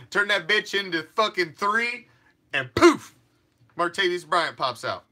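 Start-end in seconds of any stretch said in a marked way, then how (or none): 2.5-3.2 magic poof. Acted. Magic trick reveal.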